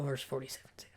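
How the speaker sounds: quick whispered aside. Real, not acted. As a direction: whisper it quickly to yourself while finding the verse. Aside while turning to the verse.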